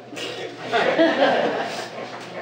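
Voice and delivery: short breathy laugh at own joke; amused laugh. Real, not acted. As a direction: let a real laugh slip out.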